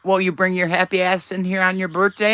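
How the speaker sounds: normal talking voice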